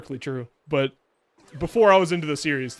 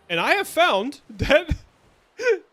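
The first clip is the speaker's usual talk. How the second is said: laughing; 10 semitones higher, 3 dB louder than usual. Real, not acted. Laughing at own disappointment.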